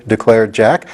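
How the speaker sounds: usual speaking voice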